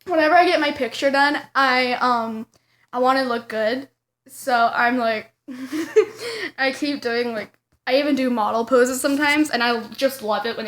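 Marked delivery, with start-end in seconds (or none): none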